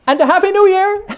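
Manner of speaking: very high, bright festive cry; cheerful calling out. Acted. Nephew calling his parting wish, very high and bright. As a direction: sing out the greeting.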